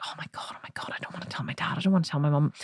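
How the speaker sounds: put-on frightened child whispering to herself; panicked whisper. Girl whispering in panic about the broken jug. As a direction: whisper it in panic, hiding the mistake. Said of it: "and I was like".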